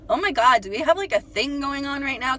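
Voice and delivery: thrilled, half disbelieving gasp; excited realization. Retold or imitated.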